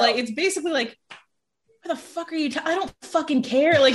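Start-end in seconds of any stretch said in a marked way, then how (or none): 1.8-3.8 high, hushed, exasperated tipsy voice; drunk mom. Retold or imitated. Drunk mom brushing off her child's confession, tipsy mock exasperation. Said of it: "she's been drinking".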